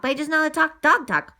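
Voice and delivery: plain reading voice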